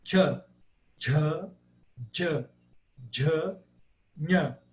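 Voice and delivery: "Tchá, tchá" and "nha" as isolated drilled syllables; palatal consonants. Shown as a demonstration. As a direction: keep the tongue on one palatal spot from "tchá" to "nha". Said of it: "no mesmo ponto de articulação". ("tchá" e "nha" no céu da boca)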